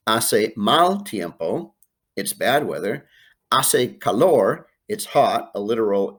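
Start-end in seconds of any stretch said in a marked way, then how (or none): 0.1-1.7 "Hace mal tiempo" said slowly, silent H; drawn-out teacher model of "Hace mal tiempo"; slow Spanish model. Shown as a demonstration. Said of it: "four answers that begin with a word called hace". 3.5-4.7 "Hace calor" said slowly, silent H, stress on LOR; drawn-out model of "Hace calor"; slow Spanish model. Shown as a demonstration. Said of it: "four answers that begin with a word called hace".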